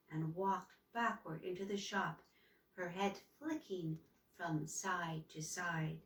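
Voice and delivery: plain reading voice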